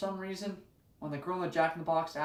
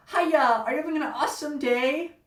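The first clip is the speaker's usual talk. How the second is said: bubbly fast-food greeting, higher and louder; perky cashier; 8 semitones higher, 5 dB louder than usual. Retold or imitated. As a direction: chirp it like an overeager cashier. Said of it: "the girl behind the counter said".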